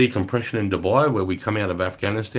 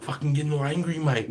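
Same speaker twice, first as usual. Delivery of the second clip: ordinary talk, nothing marked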